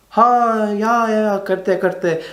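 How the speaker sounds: hammed-up impression of a flustered officer; mock Indian manager. Manager mocked with Hindi words.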